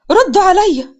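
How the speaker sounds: high, loud angry demand; yelling. A narrator's reading. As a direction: yell at the children to answer. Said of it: "سندس بزعيق". (بزعيق)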